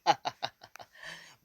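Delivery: villain's laugh in a story; put-on laughter; acted laugh. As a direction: burst into a short, mocking laugh. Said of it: "he starts laughing".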